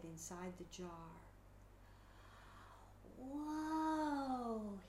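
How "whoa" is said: soft whoa. Acted. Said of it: "he said softly".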